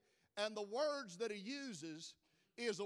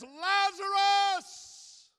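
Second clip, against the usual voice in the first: slow, much higher and louder shout; loud cry; 10 semitones higher, 16 dB louder, much slower than usual. Retold or imitated. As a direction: call him out of the grave, full voice. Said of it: "Jesus cries out ... with a loud voice".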